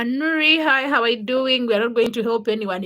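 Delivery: ordinary talk, nothing marked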